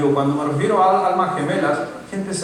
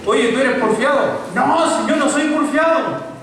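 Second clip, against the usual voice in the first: loud, high mock quarrel; stubborn protest; 9 semitones higher, 4 dB louder, slower than usual. Someone accused of stubbornness denying it, loud high mock protest. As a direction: act out an indignant back-and-forth, voice pitched up.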